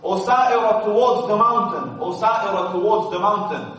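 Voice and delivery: high, loud commanding call. Retold or imitated. Umar calling his commander toward the mountain, loud high call. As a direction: shout the order to the far army. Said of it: "And he's calling from Medina".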